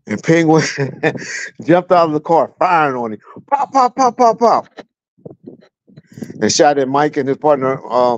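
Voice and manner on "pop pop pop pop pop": high mock pop pop gunfire; gunshot sounds. Telling how Penguin fired on the cop, mock gunshots. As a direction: spit out the pops like shots.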